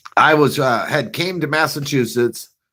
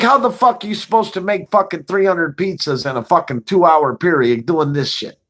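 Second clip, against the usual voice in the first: higher-pitched incredulous boss rant; mock boss rant; 7 semitones higher than usual. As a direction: scold him like the boss did. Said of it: "He's like".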